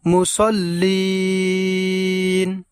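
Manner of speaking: মুসল্লিন with the লিন stretched; slow teaching drawl on মুসল্লিন; long final vowel. Shown as a demonstration. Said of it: "এই জায়গায় আমরা টান দেব এইভাবে".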